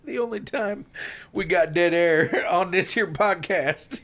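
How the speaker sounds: high-pitched words through laughter; giggly, slowing as laughter breaks in; laughing teasing. Real, not acted. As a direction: tease your co-host while cracking up. Laughing at a failed joke.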